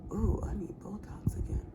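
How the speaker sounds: soft voiceless aside; hushed self-remark; whispered aside. Real, not acted. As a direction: mutter it under your breath mid-sentence. Aside while speaking.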